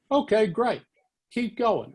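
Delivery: imitating an encouraging boss on the phone. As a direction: cheer a nervous caller on. Editor encouraging the young dictating reporter.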